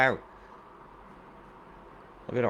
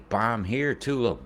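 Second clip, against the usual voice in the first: usual speaking voice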